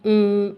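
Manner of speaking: "उं" hummed alone as nasal NG; NG sound. Shown as a demonstration. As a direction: demonstrate "उं" like the ending of ring. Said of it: "N G की sound होती है".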